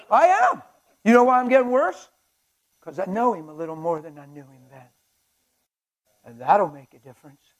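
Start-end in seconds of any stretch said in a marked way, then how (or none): 0.1-2.1 emphatic exclaim. Real, not acted. Preaching at full force.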